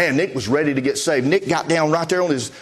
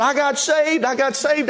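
retold excited scream; 8 semitones higher, slower than usual. New convert running up to the preacher, mock screaming. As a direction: imitate an excited cry, pitched up. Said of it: "he's screaming".

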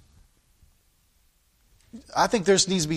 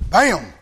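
sharp louder bam for the expected hit; imitated bam; 4 dB louder than usual. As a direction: punch the impact sound. Imitates rams colliding.

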